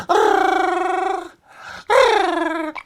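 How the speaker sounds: high, loud hammed-up dinosaur growl; dinosaur roar. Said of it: "I'll become a dinosaur".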